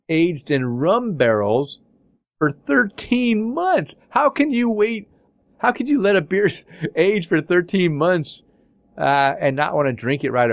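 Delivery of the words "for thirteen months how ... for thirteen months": can't believe anyone waits that long; amazed disbelief. Real, not acted. Amazed at long beer aging.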